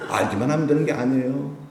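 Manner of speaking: ordinary talk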